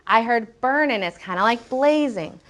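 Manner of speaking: ordinary delivery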